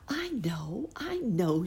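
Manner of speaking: high excited fairy voice; a sudden bright idea, high; tiny tinkling fairy voice. Acted. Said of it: "she said".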